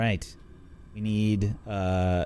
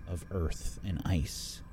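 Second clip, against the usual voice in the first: slow, hushed storyteller read; scene narration; 6 semitones lower, 6 dB quieter, slower than usual. A narrator's reading. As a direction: paint the scene slowly.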